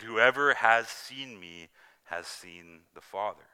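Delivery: slow, stressed repeat of the key verse; preacher repeating Jesus's words slowly for emphasis; emphatic repeat. Shown as a demonstration. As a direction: say it again, slowly, driving it home. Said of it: "He said".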